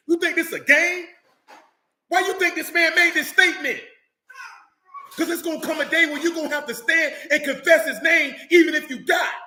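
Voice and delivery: preaching at full force. Real, not acted. Preacher daring listeners to confess the name, shouting. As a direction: fire it at them like a challenge.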